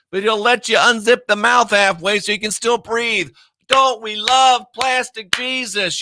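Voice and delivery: high, mocking sermon voice; mock preacher. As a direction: sneer it out like a fake sweet preacher. Mocking televangelist sermon parody.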